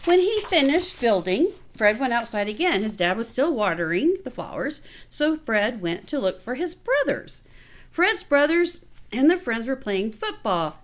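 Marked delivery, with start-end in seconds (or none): none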